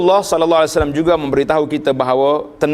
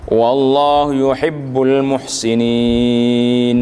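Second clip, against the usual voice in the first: Quran recitation. Acted. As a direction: recite it in the tajwid style, drawing out each phrase. Quranic verse recited before translation.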